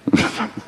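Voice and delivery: short unvoiced chuckle; quick breathy laugh; soft breathy chuckle. Real, not acted. Laughing at his own joke.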